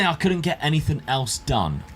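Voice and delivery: ordinary delivery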